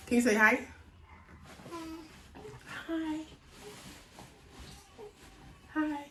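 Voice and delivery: high sing-song coaxing. Real, not acted. Mom coaxing toddler to say hi.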